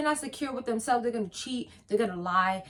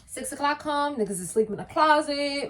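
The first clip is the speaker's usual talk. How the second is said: ordinary delivery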